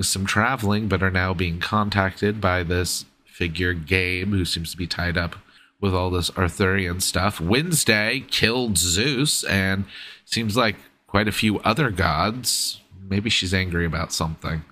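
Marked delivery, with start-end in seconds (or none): none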